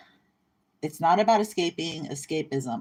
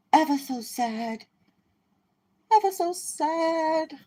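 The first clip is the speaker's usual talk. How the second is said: mock sad voice; 11 semitones higher, slower than usual. Mocking sad voice.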